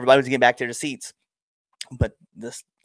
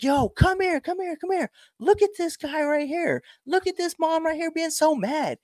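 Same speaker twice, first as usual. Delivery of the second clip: very high, excited mocking summons; jeering callout. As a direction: call friends over to gawk and jeer. Calling friends over to mock.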